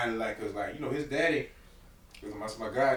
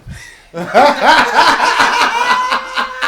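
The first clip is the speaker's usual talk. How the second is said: high, loud burst of laughter; 16 dB louder than usual. Real, not acted. Recalling hospital story, loud high laugh. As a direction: cackle out loud.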